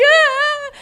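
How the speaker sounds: high, loud exaggerated wail; comic crying wail; mock bawling. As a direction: burst into a hammed-up howl. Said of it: "I'm bawling. I'm crying".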